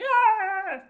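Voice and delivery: high, loud "Ah!" burst; loud outburst yell. Retold or imitated. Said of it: "I got loud. I was like".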